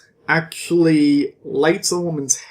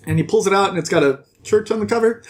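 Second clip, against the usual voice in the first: usual speaking voice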